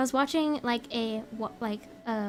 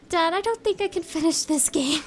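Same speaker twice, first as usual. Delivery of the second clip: mildly raised, whiny plea; mock scared; 4 semitones higher, 3 dB louder than usual. Streamer retelling her confession to her dad, mock scared. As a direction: imitate yourself nervously confessing defeat. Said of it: "I got too scared".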